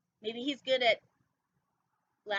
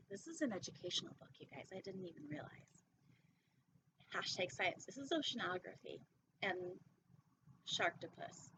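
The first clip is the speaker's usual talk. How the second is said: quiet aside; 3 semitones lower, 9 dB quieter than usual. Real, not acted. Soft aside to viewers.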